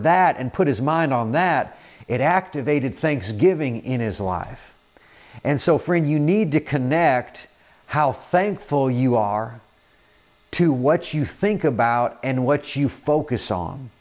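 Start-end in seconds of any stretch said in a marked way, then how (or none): none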